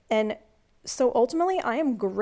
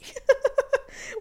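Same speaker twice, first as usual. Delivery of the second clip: giddy laugh; 3 dB louder than usual. Real, not acted. Laughing about dance party.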